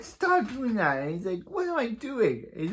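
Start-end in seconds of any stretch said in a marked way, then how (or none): 0.0-1.0 high, loud exasperated order; mock scolding. Hero tells his partner to stop, exasperated mock scolding. Acted quarrel over a shooting. 1.5-2.5 very high, incredulous comeback; mock protest. Scolded partner answers back to the hero, mock protest. Acted quarrel over a shooting.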